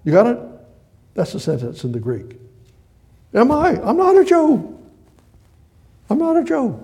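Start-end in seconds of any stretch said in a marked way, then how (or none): 3.3-6.8 very high, squealed mock protest; hammed-up high Pilate; indignant Pilate. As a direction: protest in a comic, high-pitched voice. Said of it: "Pilate says to him directly".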